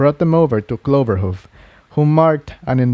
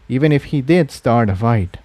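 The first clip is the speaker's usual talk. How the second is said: plain reading voice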